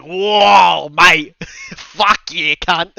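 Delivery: loud, laughing Australian exclamation; mock Aussie. Podcaster imitating Sanada meeting an Australian wrestler, loud mock Aussie. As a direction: yell it like a rowdy Aussie bloke. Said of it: "he's just like".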